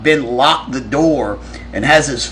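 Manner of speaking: ordinary delivery, nothing marked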